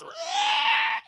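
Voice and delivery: long, high, loud demonic shriek; possessed scream. Retold or imitated. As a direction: scream as you lunge to attack. Possessed boy attacks the priest.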